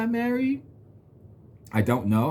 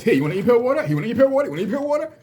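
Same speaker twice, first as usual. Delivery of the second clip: much higher, louder, hawking e-pill water; hyped party offer; 11 semitones higher, 4 dB louder, faster than usual. Retold or imitated. Imitating himself offering e-pill water at parties, high hype call. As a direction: sell the drink like a party hype man. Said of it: "Just like this".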